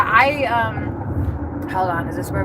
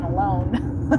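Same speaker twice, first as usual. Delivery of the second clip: laughing; 4 semitones higher than usual. Real, not acted. Laughing at her own joke.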